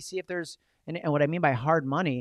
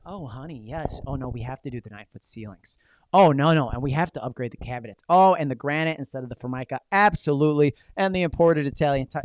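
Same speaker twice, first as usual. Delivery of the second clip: excited buyer upgrading; 6 dB louder than usual. Retold or imitated. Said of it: "because you're like".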